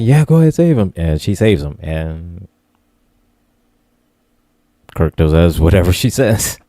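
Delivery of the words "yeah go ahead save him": higher, louder quoted consent; acted go-ahead. Husband telling wife to save his friend, raised acted voice. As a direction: lift the pitch and volume to grant permission. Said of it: "So he's like".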